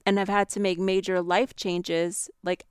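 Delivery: ordinary talk, nothing marked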